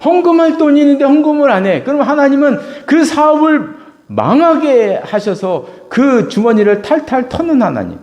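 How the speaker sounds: loud, high preaching cry; full-force preaching. Acted. Preacher crying out to his congregation, loud and high. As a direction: preach it out to the back row.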